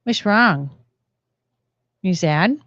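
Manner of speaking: usual speaking voice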